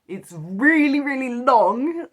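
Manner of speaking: really long, pulled high and slow; drawn-out emphasis. Real, not acted. Telling viewers how long the game is, drawn out high and slow.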